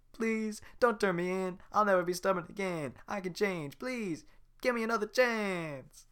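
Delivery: high pleading Donkey impression. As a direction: beg like Donkey. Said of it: "That sounds like donkey, right?".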